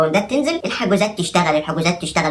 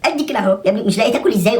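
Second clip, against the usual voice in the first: higher, mocking whine of a fake-poor man; mock complainer; 6 semitones higher than usual. Comedian mocking a well-fed man claiming hunger, high whine. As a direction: lightly ham up the hungry claimant.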